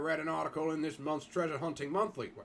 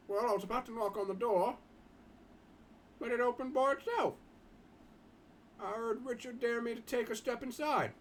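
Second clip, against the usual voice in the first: schoolboy Jim; 8 semitones higher than usual. Acted. Boy Jim telling the others about his school, higher boyish voice. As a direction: lift the voice for a polite teenage schoolboy.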